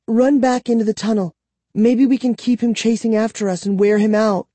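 put-on high, quick urgent girl voice; urging, fast and high-pitched. Kara urging narrator.

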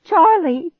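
loud, high desperate cry. A narrator's reading. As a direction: scream the name. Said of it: "I cried out".

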